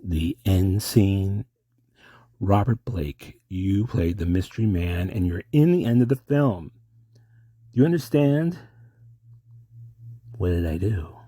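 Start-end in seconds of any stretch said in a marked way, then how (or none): none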